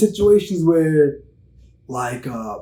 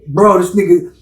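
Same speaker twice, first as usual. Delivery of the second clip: ordinary talk, nothing marked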